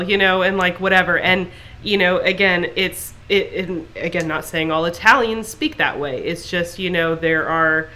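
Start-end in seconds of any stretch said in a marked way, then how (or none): none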